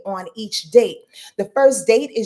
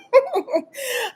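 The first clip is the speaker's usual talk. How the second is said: burst of laughter. Real, not acted. Laughing about her friend.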